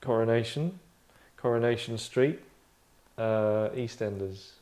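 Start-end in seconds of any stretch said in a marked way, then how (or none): none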